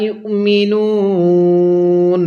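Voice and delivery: মা ইউমিনুন slow and drawn out; slow tajweed recital; slow Quran recitation. Shown as a demonstration. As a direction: chant মা ইউমিনুন slowly, stretching the vowels. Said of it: "আমার সাথে পড়ুন".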